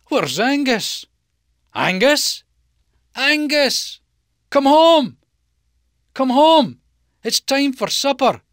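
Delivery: high, loud calling out across the field; calling the goat. Acted. Farmer calls his goat home across the field, high loud call. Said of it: "Mister McDougall calling him".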